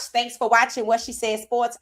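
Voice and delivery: usual speaking voice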